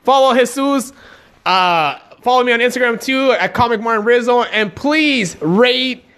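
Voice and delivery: high, loud podcast sign-off; hyped outro. Acted. Asking listeners to rate and subscribe, high and loud. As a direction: wrap up the show with big energy.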